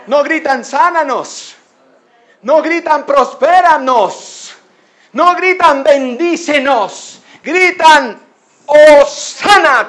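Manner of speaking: high, loud preaching shout; impassioned shouts, stretching each cry; crowd's shouted cries. Acted. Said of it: "Qué está gritando la gente".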